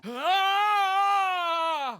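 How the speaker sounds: sudden high cry of pain. Acted. A hero struck in battle cries out, sharp and high.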